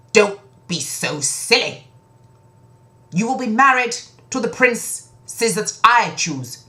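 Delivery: high, loud, firm rebuke; stern scolding king. Acted. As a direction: lay down the law.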